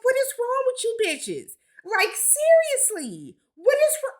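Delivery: high, exasperated disbelief. Real, not acted. Scolding the laughing women, high exasperated. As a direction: scold them like they've lost it.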